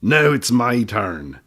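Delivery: slow, loud little gnome voice; slow stubborn gnome demand; insistent gnome. Acted. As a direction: drag out each word, claiming his turn. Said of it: "said the little man".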